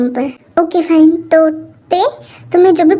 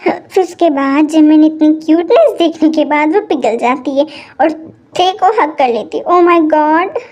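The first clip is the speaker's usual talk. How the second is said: ordinary delivery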